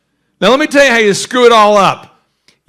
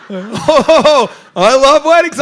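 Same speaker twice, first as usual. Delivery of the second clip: mock weeping; 7 semitones higher, 4 dB louder, much slower than usual. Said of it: "I was, like, weeping".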